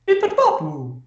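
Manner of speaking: high-pitched gibberish mock Italian accent. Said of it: "I thought the stereotypical Italian accent was".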